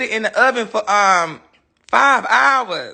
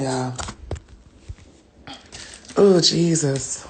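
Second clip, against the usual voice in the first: ordinary delivery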